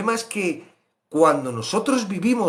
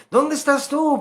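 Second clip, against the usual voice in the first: God calling; 13 semitones higher, 4 dB louder than usual. Acted. Voicing God calling Adam.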